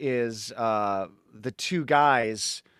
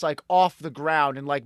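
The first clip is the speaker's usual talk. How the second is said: usual speaking voice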